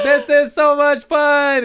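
put-on high, loud, drawn-out kid glee; squealed mock-child delight; little baby voice. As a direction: stretch it out like a thrilled toddler. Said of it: "his little baby voice".

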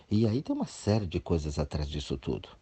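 normal talking voice

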